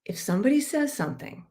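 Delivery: ordinary talk, nothing marked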